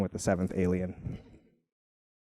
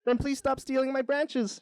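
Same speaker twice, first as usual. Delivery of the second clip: shouting tree. A narrator's reading. Tree quarrels with branch-stealing beaver, high mock shout. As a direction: protest high, like an indignant tree. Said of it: "shouts the tree".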